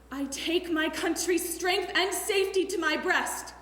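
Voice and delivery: loud, stirring call to the nation; rally the people with full force; rousing oration. Acted.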